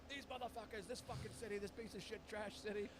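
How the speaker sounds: mock a fuming driver cursing the world; mild mock fury, not shouted; imitated angry rant. Retold driver ranting after crash.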